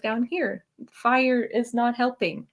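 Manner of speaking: normal talking voice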